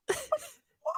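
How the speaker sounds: tiny, very high squeak; imitated high-pitched startled reaction; shocked woman. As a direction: squeak it softly in mock shock. Startled woman in car acted out.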